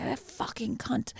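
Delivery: hushed British swearing; whispered British accent. Shown as a demonstration. Podcaster showing friend she can swear, whispered British accent. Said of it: "say it in a British accent".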